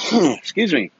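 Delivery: real sneeze, then a strained excuse me; sudden loud sneeze; sneezing. Real, not acted. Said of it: "I really apologize for the sneeze".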